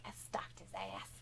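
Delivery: a whispered aside to the chat. Pretend. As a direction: confess it in a hushed whisper. Whispered confession in a quote.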